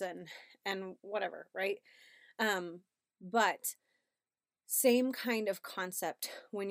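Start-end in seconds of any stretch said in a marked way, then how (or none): none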